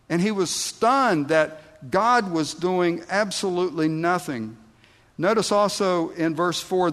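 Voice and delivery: usual speaking voice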